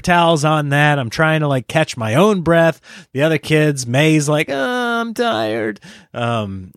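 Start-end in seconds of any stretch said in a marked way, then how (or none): 4.5-5.8 high, very slow imitation of a tired kid; whining child. May complaining to dad after running, mock whining. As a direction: drag it out in a small whiny voice.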